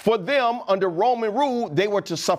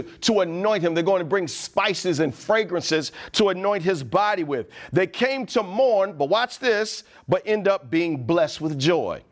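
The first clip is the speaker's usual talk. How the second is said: normal talking voice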